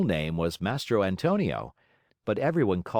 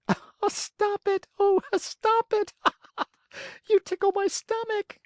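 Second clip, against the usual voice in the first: giggling tiny voice. Acted. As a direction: giggle while begging to stop. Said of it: "it giggled as it spoke".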